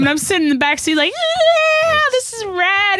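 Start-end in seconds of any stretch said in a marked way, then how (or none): 1.1-3.0 high-pitched sarcastic cheer; mock excitement. Daughter in back seat as mom curses, mock-excited cheer. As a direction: cheer with hammed-up glee.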